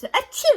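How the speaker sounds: loud, very high, overdone fake sneeze; exaggerated sneeze imitation. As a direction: ham up a coworker's dramatic sneeze. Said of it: "this is literally how she was".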